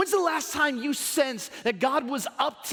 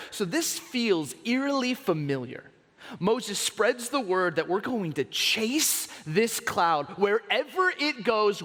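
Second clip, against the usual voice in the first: ordinary delivery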